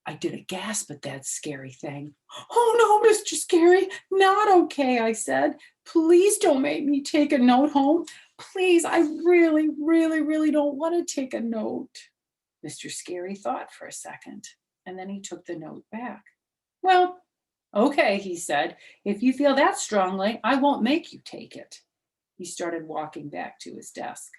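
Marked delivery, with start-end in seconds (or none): 2.5-12.1 put-on much higher, louder begging kid voice; pleading little girl. Junie B begging Mister Scary not to send a note, high and loud. 16.8-21.8 higher, louder teacher giving in; relenting teacher. Acted. Mister Scary letting Junie B off the note, louder.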